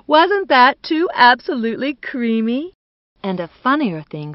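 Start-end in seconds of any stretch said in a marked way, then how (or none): 0.1-2.7 high, loud society gushing; delighted, emphatic exclamation; amused delight. A narrator's reading. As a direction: relish the joke, pitched up and loud. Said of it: "joined in the laughter".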